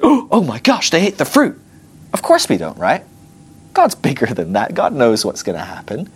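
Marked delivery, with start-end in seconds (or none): 0.0-1.6 gasping high-pitched mock shock; hammed-up horrified discovery. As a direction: gasp it as if caught unawares. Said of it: "God was like".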